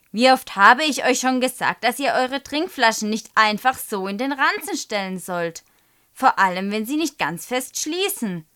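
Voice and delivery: loud, exasperated teacher's telling-off; scolding. A narrator's reading. Teacher to class about leaky drink bottles, scolding. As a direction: lay into the class, loudly.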